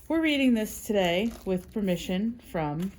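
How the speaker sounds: ordinary delivery, nothing marked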